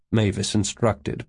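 ordinary delivery

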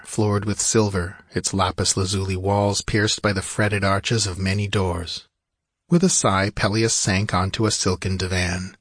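ordinary delivery

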